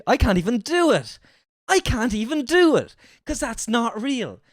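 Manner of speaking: high, loud, incredulous complaint; exasperated protest. Real, not acted. Reactor objecting that the coach's accent is fake, exasperated. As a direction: protest at full pitch, slowing at the end.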